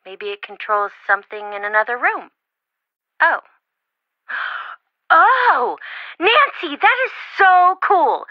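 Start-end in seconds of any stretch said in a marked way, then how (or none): 3.2-8.2 higher and louder, slow, bursting with delight; thrilled. Acted. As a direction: gush over the amazing find. Friend thrilled by discovery.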